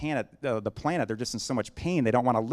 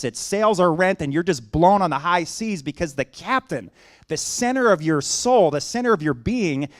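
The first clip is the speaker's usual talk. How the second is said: louder, higher, building sermon delivery; preaching at full force; 5 semitones higher, 7 dB louder than usual. Acted. Pastor urging the congregation this morning, preaching with full force. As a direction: drive it home like a pulpit preacher.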